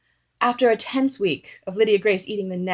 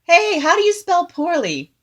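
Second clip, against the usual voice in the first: loud, high-pitched holler; imitating a yelled question; retold yell; 10 semitones higher, 7 dB louder than usual. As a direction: call it out loudly, pitched way up. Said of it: "like, yelling at him".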